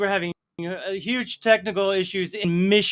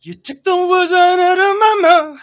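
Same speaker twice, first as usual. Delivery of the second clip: high, loud parroted take; mocking high echo; 12 semitones higher, 11 dB louder than usual. Host squeakily parrots cohost's own phrase back at him.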